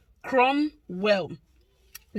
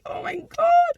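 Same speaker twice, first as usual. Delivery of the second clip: much higher, loud squeal; excited squeal; 6 dB louder, slower than usual. Real, not acted. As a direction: shriek with delight. Overwhelmed by fans' love.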